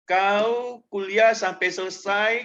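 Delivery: slow, deliberate, blunt official's offer. Retold or imitated. Dean offering the scholarship to a student, slow and blunt. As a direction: spell out the deal bluntly. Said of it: "karena udah akrab".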